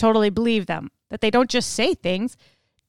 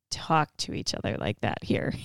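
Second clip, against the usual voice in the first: whispered aside; 11 semitones lower, 8 dB quieter than usual. Real, not acted. Host whispers to listeners about blunt talk, hushed. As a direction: whisper it, much lower and softer.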